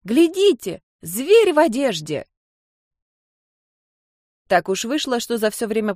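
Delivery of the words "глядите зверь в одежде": put-on high, loud child's cry; exclaiming child. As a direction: point and shout in wonder. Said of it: "воскликнула".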